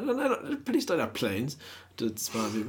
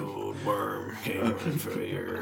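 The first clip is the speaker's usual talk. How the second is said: low storyteller voice; 4 semitones lower, 3 dB quieter, slower than usual. Pretend. Voicing the talking vagina.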